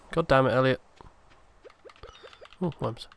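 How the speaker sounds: normal talking voice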